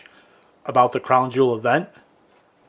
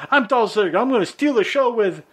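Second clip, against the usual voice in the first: cocky wrestler boast, much higher; mock Dolph Ziggler; 10 semitones higher than usual. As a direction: hype yourself up like a show-off wrestler. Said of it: "I'm like".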